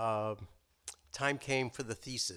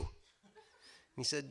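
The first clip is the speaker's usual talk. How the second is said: ordinary talk, nothing marked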